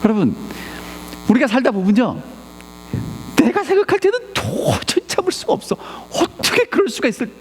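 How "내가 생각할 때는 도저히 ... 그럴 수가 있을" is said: high-pitched, exasperated protest; indignant complaint. Acted. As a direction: argue it out with God, pitched way up. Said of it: "기도할 때도 따집니까".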